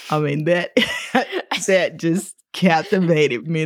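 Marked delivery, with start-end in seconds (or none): 0.8-1.6 short bright laugh. Real, not acted. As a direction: burst out laughing. Host laughs at excerpt.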